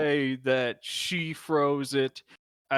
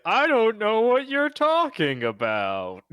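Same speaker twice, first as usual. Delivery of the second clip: feigned innocence; 9 semitones higher, 3 dB louder than usual. Acted. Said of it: "he's trying to say".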